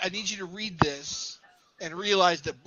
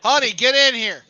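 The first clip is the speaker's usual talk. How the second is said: louder, higher call to someone off-mic; 4 semitones higher, 9 dB louder than usual. Real, not acted. Host summoning honey to come read something, calling out. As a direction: call your partner in from the next room. Calls partner into the room.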